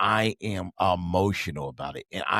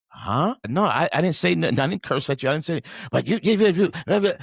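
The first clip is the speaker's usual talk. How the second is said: mock baffled protest; 8 semitones higher, 4 dB louder than usual. Defending himself, retold argument.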